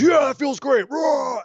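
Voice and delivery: high, loud triumphant shout; hammed-up victorious bellow; mock roar. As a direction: roar with satisfaction. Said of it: "And then goes".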